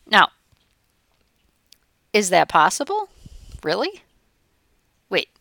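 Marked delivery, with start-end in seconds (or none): none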